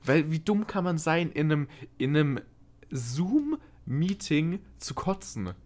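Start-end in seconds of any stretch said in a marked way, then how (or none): 2.0-5.5 slow, stretched-out disbelief. Real, not acted. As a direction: drag out the words in disbelief. Disbelief at own stupidity.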